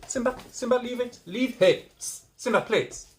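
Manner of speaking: high, loud, sharp commands; scolding a dog. Real, not acted. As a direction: call the dog off firmly.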